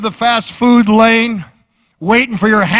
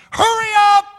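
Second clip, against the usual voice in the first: high mock shout at the microwave; hammed-up impatient yell.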